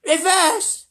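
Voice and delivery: high, loud shriek; yelling grandma. A narrator's reading. Grandma Georgina yells at Wonka to reverse, high shriek. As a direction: bark it in panic. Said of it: "yelled Grandma Georgina".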